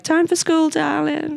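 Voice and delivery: put-on high, crackly old crone; sing-song crone mum. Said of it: "was like".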